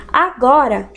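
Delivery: ordinary talk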